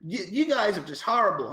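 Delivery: game officer scolding the guild in a mock American accent; American accent impression. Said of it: "your American accent impression".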